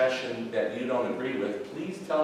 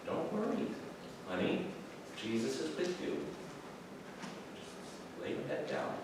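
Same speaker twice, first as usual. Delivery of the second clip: softer, higher, hushed mother's voice; mother comforts her scared son at night, softer and hushed; soft soothing mother; 7 semitones higher, 10 dB quieter than usual. Retold or imitated. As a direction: soothe the child back to sleep. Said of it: "She comes in".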